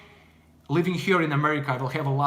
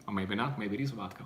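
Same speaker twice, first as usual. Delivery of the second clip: usual speaking voice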